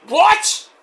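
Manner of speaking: very high, rising mock disbelief; stunned. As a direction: squeal it in astonishment. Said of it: "Callis is all stunned".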